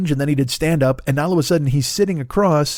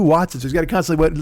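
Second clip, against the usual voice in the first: usual speaking voice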